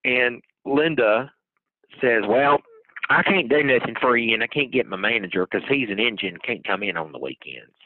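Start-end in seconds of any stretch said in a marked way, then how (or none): 2.3-7.7 raspy smoker's voice dropping low; smoker's rasp. Retold or imitated. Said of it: "How did you make your voice do that".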